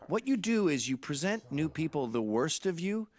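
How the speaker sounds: ordinary talk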